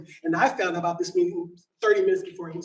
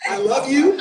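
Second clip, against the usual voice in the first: higher and much louder, beaming; excited greeting; 6 semitones higher, 10 dB louder than usual. Real, not acted. Public commenter greets a friend in the audience, delighted.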